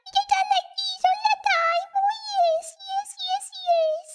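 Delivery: very high, squeaky put-on voice; chipmunk talking. Shown as a demonstration. Streamer showing talking like that the entire time, chipmunk voice. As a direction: talk like a tiny cartoon chipmunk. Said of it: "if I actually talk like that".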